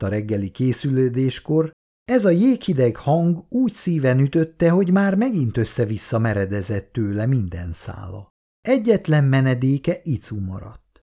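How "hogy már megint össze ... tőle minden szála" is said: ordinary delivery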